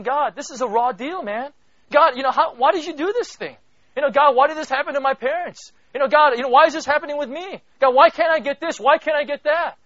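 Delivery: loud, high, pleading questions hurled at God; crying out to God. Pretend. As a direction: demand answers from God at full voice. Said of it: "You're not saying".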